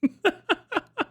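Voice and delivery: high, giggling cackle; laughing. Real, not acted. Streamer amused by his island joke, high laugh.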